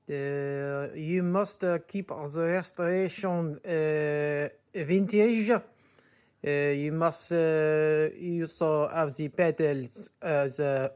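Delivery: snooty French purist voice; hammed-up French accent, insisting. French voice demands vintage pedals.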